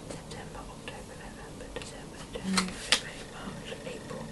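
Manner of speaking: barely audible, voiceless muttered tally; whispered counting. Real, not acted. YouTuber privately working out how many months, whispered.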